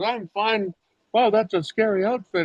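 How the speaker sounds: a hint of an impressed teenager; the girl answering. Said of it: "And she goes".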